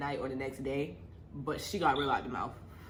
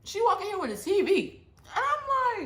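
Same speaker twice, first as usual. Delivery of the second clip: high, loud astonished voice; shocked she brought a TV, much higher; outraged disbelief; 8 dB louder than usual. Real, not acted. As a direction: squeal in disbelief.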